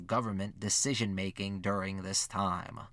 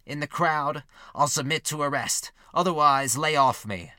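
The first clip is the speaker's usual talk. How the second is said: louder, higher quoted defiance; raised-voice warning; 4 semitones higher, 7 dB louder than usual. A narrator's reading. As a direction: project it like a warning to pursuers. Narrator quotes Everest warning pursuers.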